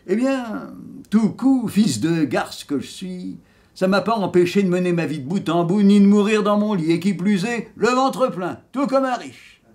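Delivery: very high, loud crowing boast; triumphant dead rogue. Acted. Dead rogue bragging he died in his bed, triumphant. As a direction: crow it out, climbing to the last word.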